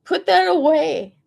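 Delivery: firm order. Retold or imitated. She orders him on the bus, firm and loud. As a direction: bark "Put that away." like a stern command. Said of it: "I said".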